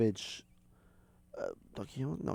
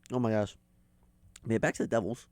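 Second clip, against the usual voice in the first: ordinary delivery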